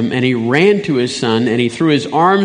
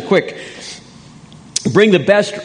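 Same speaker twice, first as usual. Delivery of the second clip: higher-pitched, urgent joy; rejoicing father; 7 semitones higher than usual. Acted. Father to his returning son, rejoicing. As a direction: call it out in eager celebration. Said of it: "He's too busy rejoicing".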